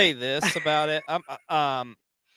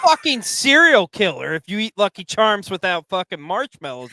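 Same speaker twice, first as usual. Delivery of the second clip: mock outrage; 5 semitones higher, 6 dB louder than usual. Real, not acted. Mock outrage at cereal eaters.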